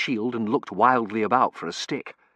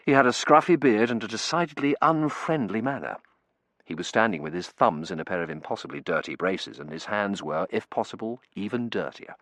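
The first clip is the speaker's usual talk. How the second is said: plain reading voice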